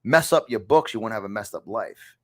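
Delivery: usual speaking voice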